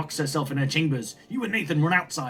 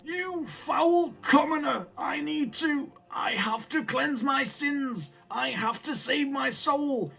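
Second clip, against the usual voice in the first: put-on high, slow, drawn-out old grandfather voice; mad grandfather, condemning; 10 semitones higher, slower than usual. Grandfather condemns his son's wife at night, high and slow. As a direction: lament your sins in a high, stretched-out voice.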